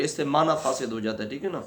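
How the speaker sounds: ordinary delivery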